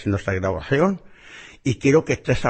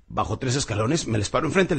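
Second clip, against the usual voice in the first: ordinary talk, nothing marked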